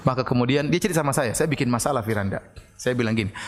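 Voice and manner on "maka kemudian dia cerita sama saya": ordinary talk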